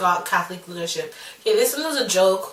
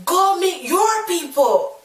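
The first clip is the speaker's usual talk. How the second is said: slow, much higher-pitched taunt; retold angry comeback; 9 semitones higher, slower than usual. Child to bully after throwing ball, acted angry retort. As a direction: throw it back at the bully, drawn out.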